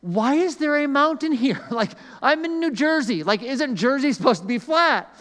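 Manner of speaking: high, laughing mock outrage at a hill; exasperated disbelief. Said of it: "And my first thought was".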